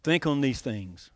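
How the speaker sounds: quoted verse. Retold or imitated. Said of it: "That's why he says".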